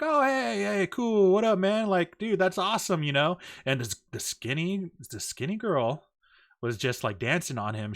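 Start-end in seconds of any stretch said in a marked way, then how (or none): 0.0-3.0 high, easygoing mock-friendly hello; lightly acted chummy approval; acted casual greeting. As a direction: play it cool, pitched up. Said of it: "I'm just like".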